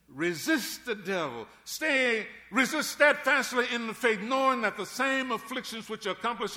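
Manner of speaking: loud, high preaching of the verse. Acted. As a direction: proclaim the verse to the church. Preaching at full force.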